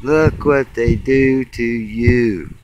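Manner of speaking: slow, dragged-out, stress on look; slow drawn repeat. Real, not acted. Repeating it at the sky, slow dragged-out lament. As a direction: draw each word out, shaking your head.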